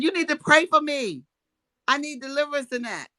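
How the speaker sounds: high, insistent retold plea; retold hyped-up plea. Woman retelling her demand that he pray, high and hyped. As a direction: pitch it up, pleading your case. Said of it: "I done went high on him".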